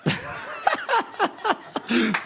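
high burst of laughter; real high laugh. Real, not acted. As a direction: laugh out high at your own joke. Laughs at own story.

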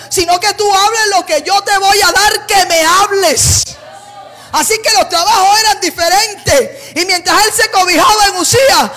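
loud, high fervent preaching voice; preaching at full force. Acted. Preacher to congregation, voicing God, fervent full-force preaching. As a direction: thunder it from the pulpit.

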